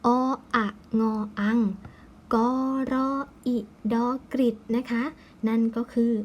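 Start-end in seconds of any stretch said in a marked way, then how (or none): 0.1-4.6 "อะงออัง", then "กอ รออิดอกริด", piece by piece; letters one by one, each blended into its syllable; spelling aloud. Shown as a demonstration. Teacher spelling for pupils, measured sounding-out. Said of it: "ก็จะอ่านว่า".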